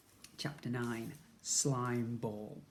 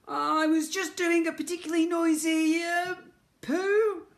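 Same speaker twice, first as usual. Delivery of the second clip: high, loud embarrassed splutter; 7 dB louder than usual. A narrator's reading. Ned spluttering an excuse through the bathroom door. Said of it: "spluttered Ned".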